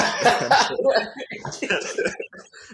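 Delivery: high, loud breathy laugh bursting out; loud unvoiced fit of laughter; real burst of laughter. Real, not acted. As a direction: crack up at the memory. Laughing at the cab driver story.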